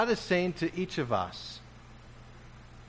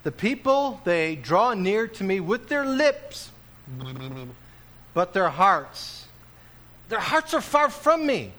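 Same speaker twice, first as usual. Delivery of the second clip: much higher and louder, slow prophetic cry; preaching Isaiah; 6 semitones higher, 5 dB louder than usual. Acted. Preacher voices Isaiah rebuking the people, loud. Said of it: "Isaiah talks about it this way".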